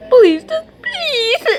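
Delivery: high, loud, wailing please; mock crying plea. Begging Cartoon Cat, fake sobbing plea. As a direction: beg half-laughing in fake tears. Pleading in fear.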